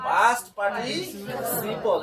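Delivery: Past participle very slow and stretched; slow teaching repeat. Shown as a demonstration. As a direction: draw out past participle for the class. Teacher repeats term slowly for class. (খুব ধীরে টেনে past participle)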